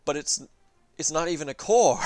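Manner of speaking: high, loud, hammed-up whiny protest; mock protest. Streamer voicing the player protesting the mandate, high and loud. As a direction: splutter the objection in mock outrage. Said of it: "And it's like".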